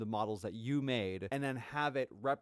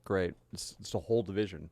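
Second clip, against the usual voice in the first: usual speaking voice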